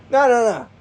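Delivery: high loud jokey voice; "no, no, no" squealed high; mock protest.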